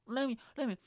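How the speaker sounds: high, hammed-up preacher voice; mock preacher. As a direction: preach it like a revival pastor, half joking. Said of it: "okay, let me preach now".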